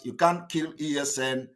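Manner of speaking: usual speaking voice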